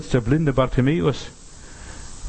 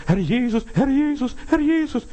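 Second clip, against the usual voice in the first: high repeated calls, climbing each time; mock crying out; 11 semitones higher than usual. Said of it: "Herr schreien".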